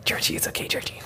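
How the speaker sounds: breathy creepy lullaby whisper; dark whisper behind you. Pretend. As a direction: whisper it like a horror clown.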